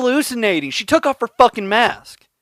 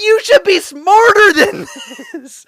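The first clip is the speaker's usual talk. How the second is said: high, slow, half-laughing plea; a laughing cry of disbelief; laughing exasperation; 10 semitones higher than usual. Retold or imitated. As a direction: scold her while cracking up. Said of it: "I was like".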